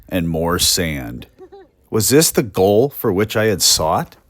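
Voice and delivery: reading an old letter aloud with mock pathos; dramatic reading. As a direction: give the lonely railroad clerk's words their full gloom. Reads an 1893 letter aloud.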